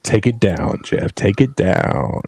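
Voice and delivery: low, close-mic ASMR voice; mock ASMR, low and intimate; playful ASMR. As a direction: murmur right into the microphone, lingering between words. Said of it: "not the A S M R".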